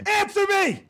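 very high imitation screech; yelling ringtone. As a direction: screech it like a scary ringtone demanding pickup. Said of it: "why are you yelling at me".

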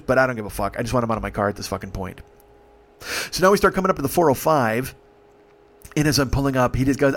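ordinary delivery

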